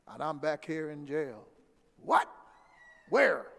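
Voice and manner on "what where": a retold father's alarmed bark; startled dad. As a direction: imitate dad jolting upright on the phone.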